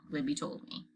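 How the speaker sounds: plain reading voice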